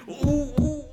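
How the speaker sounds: high, loud oohs; mock eagerness. Preacher mimicking people who should claim God, mock eagerness.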